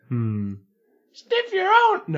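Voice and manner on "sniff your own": high squeaky character voice. Acted. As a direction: pitch way up for the line. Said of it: "what voice should I use?".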